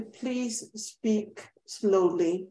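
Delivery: very slow, spaced-out words; deliberately slow. Real, not acted. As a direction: draw each word out for the interpreters. Models slow speech while requesting it.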